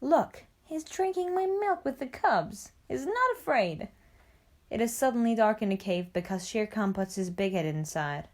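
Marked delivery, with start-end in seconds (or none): 0.0-3.9 high-pitched, delighted exclamation; happy. A narrator's reading. Mother Wolf watches the baby drink, happy. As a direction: marvel happily in a much higher voice. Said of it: "Mother Wolf is happy and says".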